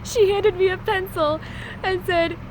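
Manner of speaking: ordinary delivery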